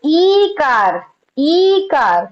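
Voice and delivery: ईकार with a long held ee; stretched long vowel. Shown as a demonstration. Teacher reminding students of the rule, ईकार drawn out. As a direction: drag out the first vowel of ईकार, slowly. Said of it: "इसको खींचा जाता है ना".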